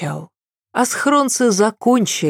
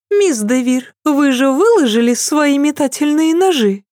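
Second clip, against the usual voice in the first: higher voice, feigned seriousness; mock-serious princess; 10 semitones higher, 3 dB louder than usual. A narrator's reading. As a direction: ask it with a straight face, teasing. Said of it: "С самым серьёзным видом поинтересовалась Сесиль". (нарочито серьёзный тон принцессы)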